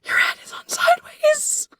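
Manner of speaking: urgent high whisper; whispered warning. Retold or imitated. Friend whispering to her in the costume, urgent whisper. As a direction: hiss it under your breath at her. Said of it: "And he's like".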